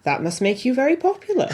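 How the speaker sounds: a light Welsh lilt, politely; Welsh accent. Retold or imitated. Welsh bard searching for something polite, Welsh accent. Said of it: "apologies to anyone from Liverpool or Wales".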